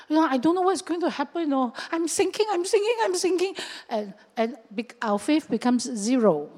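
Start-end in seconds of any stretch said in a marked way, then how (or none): 0.1-3.5 high, rising panicky voice; acted panic. Overwhelmed believer like Peter starting to sink, acted panic. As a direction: repeat it higher, as if going under. Acting out overwhelmed doubter going under.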